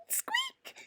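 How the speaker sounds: very high, soft mouse squeak; tiny squeak. A narrator's reading. Frightened mouse squeaking at the lion.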